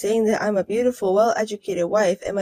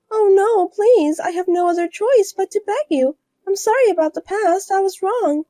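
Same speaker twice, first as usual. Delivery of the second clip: higher, louder pleading; higher voiced woman begging her ex-rival for help; desperate rival wife; 9 semitones higher, 3 dB louder than usual. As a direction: beg in a higher voice.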